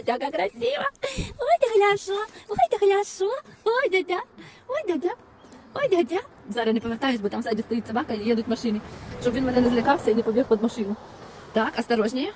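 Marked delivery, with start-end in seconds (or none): 0.0-6.2 very high pet-talk voice; high-pitched cooing at a stray dog. Real, not acted. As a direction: coo up high like talking to a puppy.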